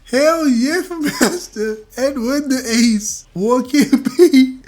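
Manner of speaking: reading through a giggle fit; laughing. Real, not acted.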